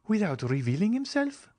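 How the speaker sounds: put-on high, curious young woman's voice; wondering young woman. Julie asks about the secret benefactor, high and wondering. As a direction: ask with wide-eyed wonder.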